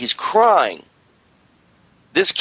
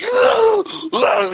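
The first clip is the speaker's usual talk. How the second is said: high mock wail dropping in pitch; imitated bawling noise. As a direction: wail like a dying animal, mimicking a crier. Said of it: "He starts making that noise".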